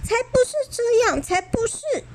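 put-on high, fast childish retort; protesting kids. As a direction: snap back in protest. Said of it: "反驳".

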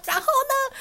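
teacher pressing. Retold or imitated. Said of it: "然后老师就说".

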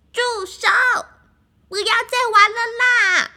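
high, loud furious yell; angry shout. Acted. Duckling finally loses it, shouting high and loud. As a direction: scream at them to stop. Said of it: "鸭子大叫一声".

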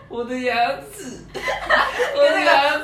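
high, very slow mock whimper through laughter; crying kid. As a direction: imitate the hurt boy wailing over his tooth, laughing. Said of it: "他就捡起自己的牙齿说".

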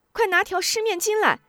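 high, anxious order to the servants; urgent call. A narrator's reading. Wife calls servants for a towel, high and urgent. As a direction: shout for a wet towel, worried. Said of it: "急喊".

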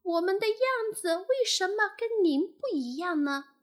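high-pitched, puzzled little tadpole. A narrator's reading. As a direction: ask mum in a high, wondering voice. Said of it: "疑惑地说". (小蝌蚪高声疑惑地问)

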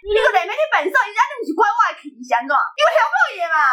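high whiny complaining mom; nagging mom lecture. Retold or imitated. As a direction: grumble it like a sore loser mom. Mom griping after losing. (高音抱怨的妈妈)

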